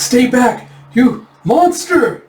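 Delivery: high, loud cry of horror; acted panic, loud and high; terrified shout. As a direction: yell to fend off a monster. Bandit wards off the dragon.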